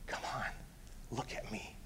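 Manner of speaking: very soft, low, hushed coaxing; hushed, tender invitation; gentle coaxing. Retold or imitated. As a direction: coax a frightened animal very quietly. Jesus coaxing horse into trailer.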